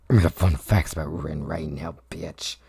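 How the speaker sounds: very low, dropped-down voice; mock menacing demand. Demanding fun facts about Rin, mock menace.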